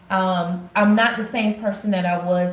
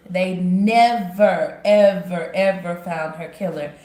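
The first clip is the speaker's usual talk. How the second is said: "never, ever" punched slow and louder; stress on never ever; 3 dB louder, slower than usual. Real, not acted. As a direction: hammer every "ever" slowly. Grieving a murder case that was never solved.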